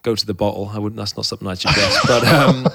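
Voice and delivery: ordinary talk, nothing marked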